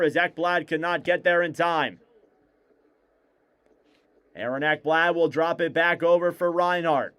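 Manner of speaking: play-by-play. Acted. Live hockey play-by-play.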